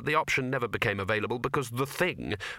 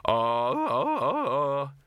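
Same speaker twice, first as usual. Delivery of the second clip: short ah-ah cries as he swings; breathy little ahs on each arc; rhythmic swinging grunts; 3 semitones higher, much slower than usual. Acted. Librarian swinging across the University, rhythmic cries. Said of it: "transcribe the noises made during the flight".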